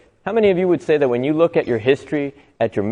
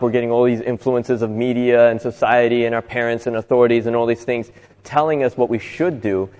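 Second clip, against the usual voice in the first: normal talking voice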